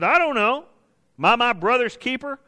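high-pitched, cheeky back talk; smart-mouthed. Acted. Preacher acts Cain talking back to God, smart-mouthed. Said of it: "Notice Cain's smart mouth".

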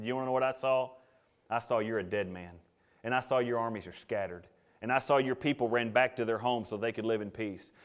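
low, soft pronouncement of doom; grave prophecy. Acted. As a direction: deliver the death sentence quietly.

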